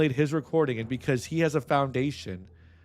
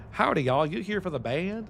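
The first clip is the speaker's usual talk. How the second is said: put-on strong Texas accent; 7 semitones higher than usual. Interviewee mimics the East Texas front-desk person's Texas accent. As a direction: put on a Texan greeter's accent. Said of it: "the accent was strong there".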